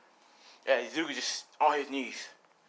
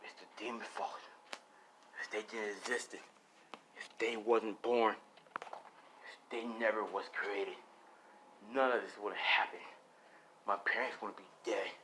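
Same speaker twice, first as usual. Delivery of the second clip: brooding son; 4 semitones lower, 9 dB quieter than usual. Acted. Son blaming demons for his parents' death, low brooding.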